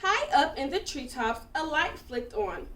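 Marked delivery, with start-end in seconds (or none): none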